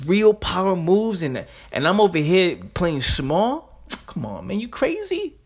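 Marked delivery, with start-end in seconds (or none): 4.6-5.4 a sudden high squeak of disbelief; you must be out of your mind; incredulous. Real, not acted. To listeners, mocking the idea of playing small, a high incredulous squeak.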